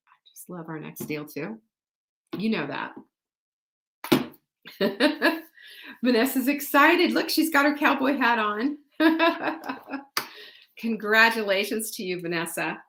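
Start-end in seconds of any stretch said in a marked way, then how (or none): 0.1-1.6 unvoiced whispered aside. Real, not acted. Host whispering a confidence to her viewers. 4.8-5.4 laugh. Real, not acted. Laughing at Vanessa's outfit. 9.0-10.2 laugh. Real, not acted. Laughing at Vanessa's cowboy hat.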